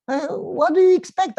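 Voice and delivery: high, loud, incredulous retort; a hammed-up indignant outburst; exasperated. Preacher mocking the lady who said prayer didn't help, high and loud.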